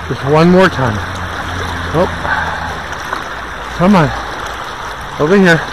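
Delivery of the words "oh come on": ordinary talk, nothing marked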